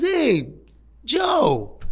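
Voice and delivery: high called-out yell; yelling across the room. Retold or imitated. Son calling out to his dad from the back room, yelling. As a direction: call out to your dad from the back. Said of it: "he yells in the back".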